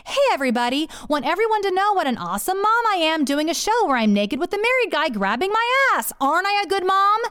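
high, mock-perky fake post; sarcastic sing-out, pitch climbing; mocking impersonation. Wife reading her husband's fake post as her, mocking high voice. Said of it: "posted on Facebook as me".

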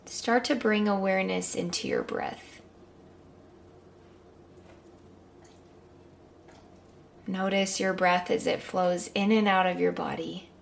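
calm guided meditation. Acted.

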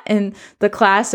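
usual speaking voice

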